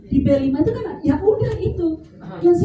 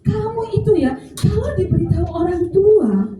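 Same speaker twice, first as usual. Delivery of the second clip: a touch of a teacher scolding, higher; lightly hammed-up telling-off; mock scolding; 6 semitones higher than usual. Shown as a demonstration. Teacher scolding pupils who ignore parents, mock angry. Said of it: "waktu marah ke anak-anak".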